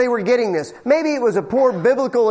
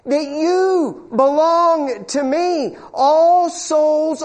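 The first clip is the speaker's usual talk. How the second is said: high, slow, tender voice of God; 6 semitones higher, much slower than usual. Acted. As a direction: speak as God, slow and aching with love. Preacher voicing God's love.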